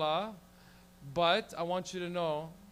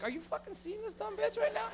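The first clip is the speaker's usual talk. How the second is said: incredulous stare. Retold or imitated.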